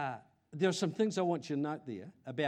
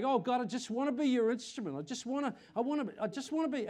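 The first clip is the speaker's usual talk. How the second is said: higher, earnest praying voice, rushing at the end; lightly acted eager prayer; retold prayer; 9 semitones higher than usual. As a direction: pray it out eagerly. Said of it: "I feel like I'm praying away".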